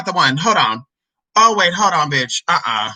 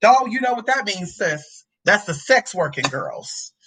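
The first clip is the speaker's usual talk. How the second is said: ordinary talk, nothing marked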